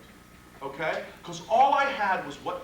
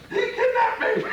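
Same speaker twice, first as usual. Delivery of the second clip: high, loud hammed-up cry of a kidnapping victim; mock wife's scream; 13 semitones higher, 7 dB louder than usual. Lawyer mimicking the complainant's wife accusing the client, a hammed-up high shriek. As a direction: shriek it like the outraged wife, half laughing.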